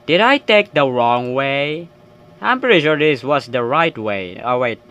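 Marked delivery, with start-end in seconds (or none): none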